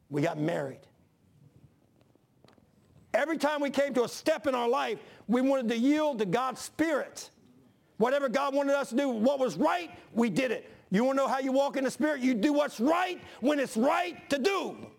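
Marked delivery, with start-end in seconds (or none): 3.1-14.9 high, ringing sermon delivery; impassioned preaching. Real, not acted. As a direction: drive it home from the pulpit, pitched high. Preacher builds to full force.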